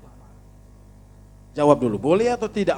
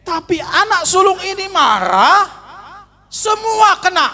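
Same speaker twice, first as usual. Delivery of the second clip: much higher and louder, fervent pulpit cry; preaching at full force; 7 dB louder than usual. Acted. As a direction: exclaim it to the whole congregation. Preacher shouting the point. (khotbah berapi-api, suara tinggi dan keras)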